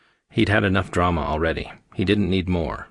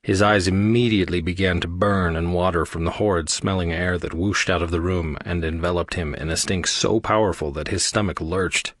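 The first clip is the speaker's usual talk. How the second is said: plain reading voice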